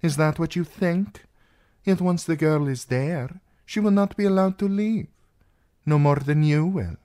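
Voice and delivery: insistent princess. Acted. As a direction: argue as a forceful young princess. Narrator voices the princess in argument.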